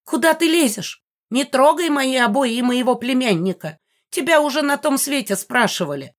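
high-pitched indignant yell; outraged ghost hollering; angry yelling. A narrator's reading. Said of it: "орала Мегара".

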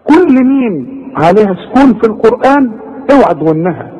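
normal talking voice

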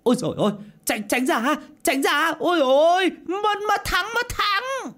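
very high-pitched frantic yell; panicked screaming. A narrator's reading. Coachman with runaway horse warning people off, screaming. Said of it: "gã chỉ biết la hét".

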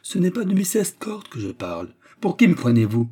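great dignity. A narrator's reading. Manager rejecting the suitor's guess, grandly dignified. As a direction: protest with a show of dignity. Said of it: "avec un grand déploiement de dignité".

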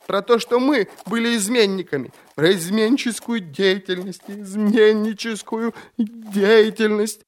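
very high, panicked cries; frantic confession. A narrator's reading. Prisoner confessing treason until cut off, frantic.

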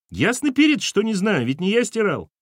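put-on very high-pitched boyish backtalk; cheeky child. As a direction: argue back like a pert kid. Boy talks back to mother.

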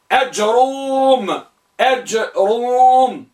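أجروم slowly, short a and long-held rum; short hamza fatha. Shown as a demonstration. Teacher dictating the sixth type, slow long final syllable. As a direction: clip no sound, stretch the ru in أجروم. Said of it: "بفتح الهمزة".

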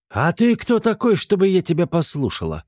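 high, challenging cook's voice; defiant cook. Acted. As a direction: hands on hips, challenge him.

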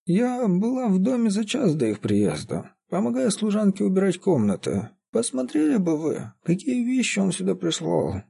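put-on high-pitched old woman, plaintive gossip; whining old caretaker. A narrator's reading. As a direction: reminisce in a high, whiny old voice. Voices the half-deaf old caretaker.

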